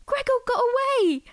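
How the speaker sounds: high, loud cry of relief; joyful shout. Acted. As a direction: cheer as he escapes. Cockroach survives, joy.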